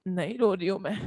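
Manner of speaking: lightly hammed-up girlfriend pretending she isn't crying; mock sniffly denial on the phone; mock teary girlfriend. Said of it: "बोले".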